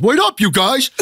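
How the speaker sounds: put-on high-pitched kid calling after friends; straggling kid. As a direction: squeal it like the kid left behind. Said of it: "like the fat kid getting into the movies".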